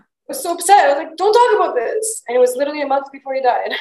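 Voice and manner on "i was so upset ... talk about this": high, louder voice near tears; tearful upset. Real, not acted. Remembering his last month, high tearful upset. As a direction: choke it out, upset.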